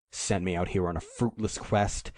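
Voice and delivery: ordinary delivery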